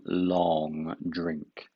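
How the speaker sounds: ordinary delivery, nothing marked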